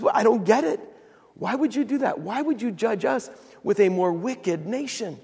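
high-pitched, baffled complaint; Habakkuk complaining. Retold or imitated. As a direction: imitate a prophet pleading for an explanation. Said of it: "he complains to the Lord".

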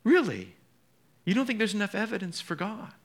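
mock astonished question; incredulous. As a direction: throw the excuse back in disbelief. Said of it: "and I just think".

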